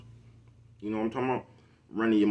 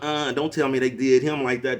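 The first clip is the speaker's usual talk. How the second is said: gossipy woman; 3 semitones higher than usual. Retold or imitated.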